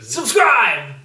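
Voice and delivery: loud, punchy plug; mock promo shout; call to subscribe. Real, not acted. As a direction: bark it at the viewers. Calling on viewers to subscribe.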